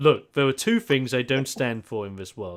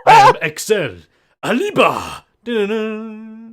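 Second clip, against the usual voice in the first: put-on loud, hammy Spanish accented wrestler boast; 8 semitones higher, 9 dB louder, slower than usual. Player voices masked wrestler NPC in a hammy Spanish accent. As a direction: announce yourself like a Spanish luchador. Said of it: "he sounds like a Slav Spanish man".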